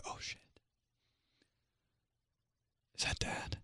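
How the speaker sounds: hushed, breathy panic whisper; scared whisper. Retold or imitated. Kids hearing dad at the door, frightened whisper. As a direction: whisper it like you might get caught.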